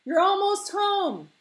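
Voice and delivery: high, slow, loud call; strong encouraging shout. Acted. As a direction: shout encouragement across the water. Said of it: "Grandpa's voice was as strong as a tow rope".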